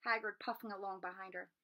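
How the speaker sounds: ordinary delivery, nothing marked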